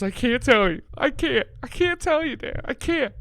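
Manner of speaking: high-pitched mock crying kid; whiny, tearful child impression; sobbing son. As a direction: imitate your kid wailing through tears. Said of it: "He starts sobbing, crying".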